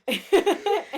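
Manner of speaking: sudden high loud laugh; laughing at the line. Real, not acted. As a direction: burst out laughing. Laugh after punchline.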